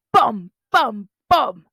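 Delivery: booming, loud beats; loud booming footsteps. A narrator's reading. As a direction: pound out each beat. Footstep sounds.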